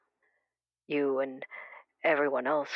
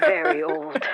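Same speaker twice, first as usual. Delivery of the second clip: laughing; 13 semitones higher, 9 dB louder than usual. Acted. Caller laughs at her own age.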